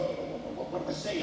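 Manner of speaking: unvoiced, panicky whisper of a shy student called on in class; nervous whisper. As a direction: whisper it like you're scared to be heard. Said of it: "and you, you go".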